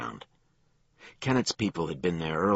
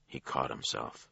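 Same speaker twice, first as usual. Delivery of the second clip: ordinary delivery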